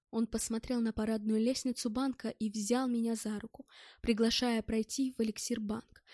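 plain reading voice

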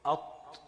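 أط said as glottal a straight into a doubled ṭ; sounds out assimilated aṭ. Shown as a demonstration. Teacher shows students the merged sound, أط with no l. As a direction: say أط with no l sound at all. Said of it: "وتبقى إيه؟".

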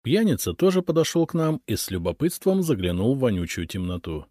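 ordinary delivery, nothing marked

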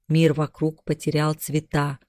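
plain reading voice

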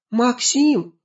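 high, loud call of a name. Acted. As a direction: shout his name while running up. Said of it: "крикнула ему Аня".

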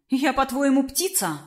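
lightly incredulous question, lifted then dropping away; a touch of mocking disbelief; wry scoff. A narrator's reading. Princess answers the dragon's order to flap, wry scoff. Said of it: "хмыкнула девушка".

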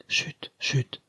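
breathy whispered shush; hushing whisper. Acted. Dying mother hushes her frightened daughter, whispering. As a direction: hush her softly, under the breath.

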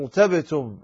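"ارتبتم" with a heavy, full ra. Shown as a demonstration. Teacher quoting the word correctly, "ارتبتم" with heavy ra. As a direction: thicken the ر in "ارتبتم". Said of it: "لا تقرأها".

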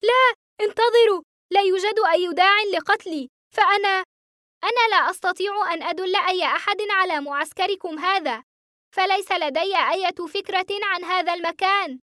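high, urgent cry of protest; panicked screaming. A narrator's reading. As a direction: plead frantically, pitch way up. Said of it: "ولكنني صرخت".

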